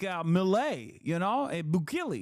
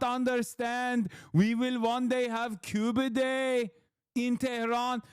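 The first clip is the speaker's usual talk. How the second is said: hammed-up Persian accent, higher and slower; 4 semitones higher than usual. Streamer showing off his Iranian accent impression. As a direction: lay on a thick Persian accent for laughs. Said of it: "I can do a pretty good Iranian".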